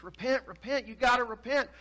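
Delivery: high, pleading prophet's plea; Jeremiah pleading. Retold or imitated. Prophet begging his people to repent, high pleading. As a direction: beg them to repent, voice straining high. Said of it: "he continues to preach".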